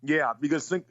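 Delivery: catching on the throat; words snagged in the throat. Real, not acted. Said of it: "sorry, I'm chewing a breath".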